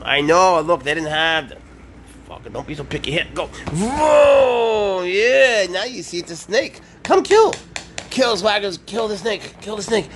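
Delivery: excited pet talk. Real, not acted. Owner luring the cat to a toy snake, high excited baby talk. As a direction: get the cat hyped up.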